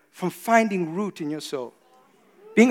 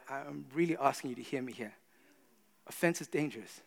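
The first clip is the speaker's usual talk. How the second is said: ordinary talk, nothing marked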